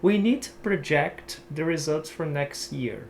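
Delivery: slow model sentence, project stressed proJECT; example sentence. Shown as a demonstration. Teacher modelling the verb project for students. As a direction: read it slowly, careful English. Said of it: "essa ênfase na outra sílaba".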